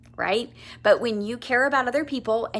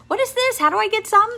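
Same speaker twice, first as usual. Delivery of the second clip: much higher, excited questions; eager curiosity; 11 semitones higher, 5 dB louder, faster than usual. Retold or imitated. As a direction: ask like you need it now. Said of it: "Then people are like".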